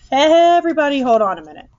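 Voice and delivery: loud, high, commanding halt; calling for attention. A narrator's reading. Doctor halts the reunion.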